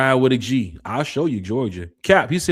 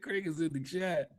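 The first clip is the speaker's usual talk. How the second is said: soft laughing aside; 5 semitones higher, 11 dB quieter than usual. Real, not acted. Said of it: "repeated softer, slower".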